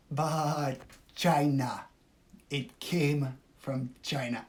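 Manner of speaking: slow, drawn-out Trump voice leaning on China; Trump impression. Streamer trying out her Trump impression, slow and drawn out. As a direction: stretch out China like Trump at a podium. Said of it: "I wanna work on my Trump".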